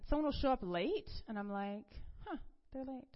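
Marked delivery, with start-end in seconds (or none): 2.3-3.1 soft, higher, mild little remark; note it lightly, without taking offense; unbothered. Retold or imitated. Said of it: "Whereas before, I'd be like".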